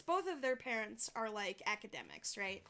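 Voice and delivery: ordinary talk, nothing marked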